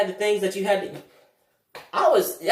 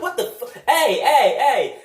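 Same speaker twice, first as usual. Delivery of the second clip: alarmed; 4 semitones higher, 4 dB louder, slower than usual. Retold or imitated. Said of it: "I was like".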